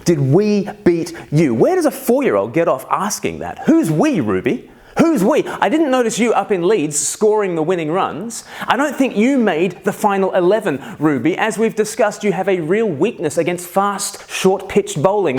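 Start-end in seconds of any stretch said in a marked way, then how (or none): 0.0-1.5 slow, punched-out mock outrage; mock indignant repeat. Dad appalled by daughter's cheek, mock indignant. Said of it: "what kind of question is that". 3.7-15.4 high-pitched, indignant dad rant; mock scolding. Dad telling off four-year-old cricket fan, mock indignant.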